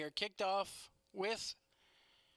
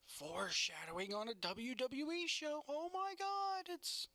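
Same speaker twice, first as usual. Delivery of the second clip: mock amazement; 6 semitones higher than usual. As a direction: pitch climbing into high, strained astonishment. Mock amazement at WWE foreshadowing.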